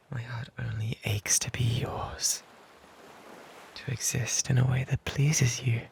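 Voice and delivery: breathy whispered confession; hushed lovesick whisper. Acted. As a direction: whisper it close, as if only she may hear.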